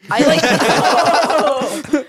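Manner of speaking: usual speaking voice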